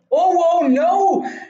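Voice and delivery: high, loud dismayed no; shocked cry. Real, not acted. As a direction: yelp at the disaster. Sudden loss in game.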